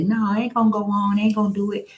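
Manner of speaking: host retelling her own refusal, lightly mocking; reluctant self. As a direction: lightly act out not wanting to come. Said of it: "I was gonna say".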